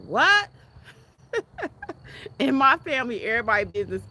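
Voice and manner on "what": high, loud shocked yelp; a squeal of shock at the idea; shocked what. Retold or imitated. Mocking her family's shocked reaction to privacy.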